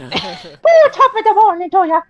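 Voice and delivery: very high cartoon Irish lilt; leprechaun accent. Answering the leprechaun jibe, hammed-up Irish accent. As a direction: play a squeaky leprechaun greeting everyone. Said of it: "that's a fucking leprechaun accent".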